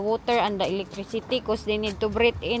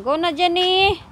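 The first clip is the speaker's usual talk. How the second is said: calling out; 8 semitones higher, 8 dB louder than usual. Real, not acted. Mother urging daughter onward outdoors.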